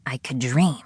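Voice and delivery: ordinary delivery, nothing marked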